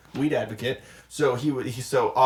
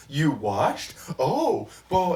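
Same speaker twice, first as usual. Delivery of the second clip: mock-snide echo of the host; light snarky mimic of Joe Rogan; snarky imitation; 3 semitones higher, much slower than usual. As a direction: sneer it back like a smug interviewer. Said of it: "he's so snarky".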